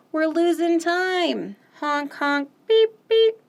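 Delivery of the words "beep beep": high, loud toy horn beep; squeaky horn beep. Acted. Teacher reading the refrain, loud squeaky horn beep.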